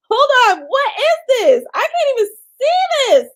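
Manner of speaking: high, loud, incredulous outburst; shocked disbelief. Real, not acted. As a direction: exclaim in amazement at the absurdity. Shocked by what appears on screen.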